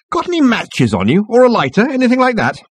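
high-pitched, eager captain's call; genial captain. A narrator's reading. Captain begs a light off the newcomer, calling out. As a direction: ask it high and airily.

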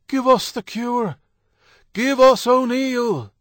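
loud, high crowd shout; mob shouting. A narrator's reading. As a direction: yell it like an angry mob. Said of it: "the mob that was shouting out there".

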